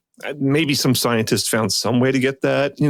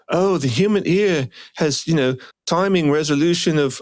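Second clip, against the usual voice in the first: bad Australian accent; 5 semitones higher than usual. Mocking the presenter's human-ear claim, bad Australian accent. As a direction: attempt Australian and land near British. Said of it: "I really am bad at this".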